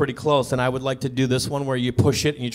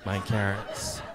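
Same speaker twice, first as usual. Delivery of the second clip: flat unamused woman; 7 semitones lower, 5 dB quieter, much slower than usual. Retold or imitated. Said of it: "She goes".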